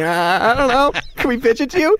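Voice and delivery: mock-nervous; sheepish. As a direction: fumble an answer then plead. Pitchers answering awkwardly.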